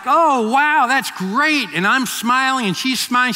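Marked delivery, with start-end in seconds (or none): 0.0-1.7 high, loud fake delight; a bright, over-the-top oh wow; mock excited. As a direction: play up the forced enthusiasm. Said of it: "And so I was like".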